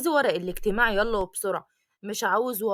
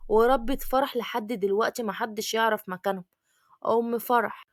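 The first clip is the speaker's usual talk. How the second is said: plain reading voice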